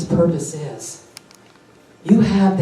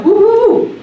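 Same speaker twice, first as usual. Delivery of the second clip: excited whoop; 13 semitones higher, 5 dB louder than usual. Real, not acted. Preacher showing her delight in giving, a kid's excited whoop. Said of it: "I get excited like a little kid".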